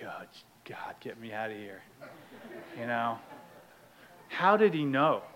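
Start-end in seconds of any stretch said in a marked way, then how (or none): 0.0-1.8 very soft, low aside; muttered private plea. Retold or imitated. Newcomer stuck in a hug, muttered plea. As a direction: mutter it under your breath.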